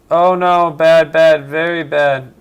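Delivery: loud sudden alarm, voice jumping up; alarmed outcry. Real, not acted. Gamer spots his own blunder mid-match, loud alarm. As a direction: yelp as the game goes wrong.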